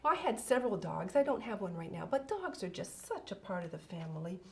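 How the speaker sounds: usual speaking voice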